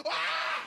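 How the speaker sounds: high sudden yelp of surprise; mock yelp. Comic yelps as the pie lands. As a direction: yelp like someone who just got pied.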